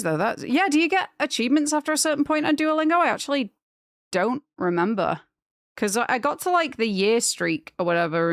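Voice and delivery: usual speaking voice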